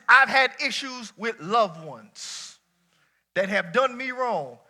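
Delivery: slow, weighty, every word landed; slow emphatic preaching. Shown as a demonstration. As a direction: lay it out slowly. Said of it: "Flip that".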